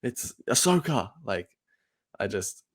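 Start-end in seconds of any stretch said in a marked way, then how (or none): 0.0-1.1 high, slowed mock gasp of amazement; lightly hammed-up fan excitement, pitched high; mock fan hype. Mocking fans awaiting the next cameo, feigned amazement.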